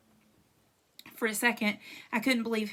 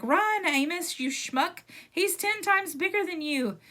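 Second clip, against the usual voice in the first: imitating himself; 9 semitones higher, 4 dB louder, slower than usual. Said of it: "I was like".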